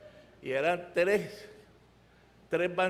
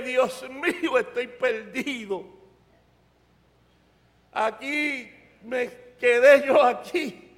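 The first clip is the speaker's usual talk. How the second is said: slow, higher-pitched retold lament; retold tearful prayer; 5 semitones higher, 6 dB louder, slower than usual. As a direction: mock-desperate raised plea to God. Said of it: "empecé a llorar y dije".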